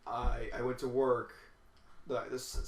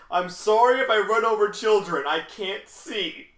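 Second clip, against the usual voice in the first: higher, much louder, slow; sarcastic loud call; 7 semitones higher, 11 dB louder, slower than usual. Retold or imitated. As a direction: bellow the apology sarcastically. Coworker's sarcastic exit line.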